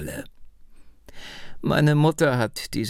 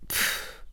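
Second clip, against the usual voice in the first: a short, breathy, unvoiced pff; dismissive pff; 7 dB quieter than usual. A narrator's reading. Old woman waves off his talk of money with a pff. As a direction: puff air out, brushing off the idea. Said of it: "machte sie".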